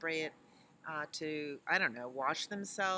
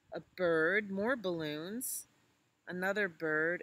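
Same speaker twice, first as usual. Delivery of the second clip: ordinary talk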